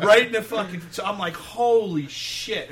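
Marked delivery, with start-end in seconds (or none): 1.3-2.7 slow, high, louder astonished exclamation; stunned holy shit. Retold or imitated. Band member stunned when the passenger knocks the guitarist out. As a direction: drag it out in shock. Reacting to the punch.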